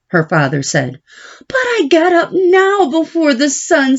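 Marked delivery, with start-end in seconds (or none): 1.5-4.0 put-on higher girl's voice, pleading; a daughter begging papa for help; young Babylona pleading. Babylona protests to father.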